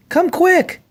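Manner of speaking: calling out high and urgent; a zookeeper alerting a colleague, urgent high call. A narrator's reading. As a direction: hurry them with a high call. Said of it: "she yelled".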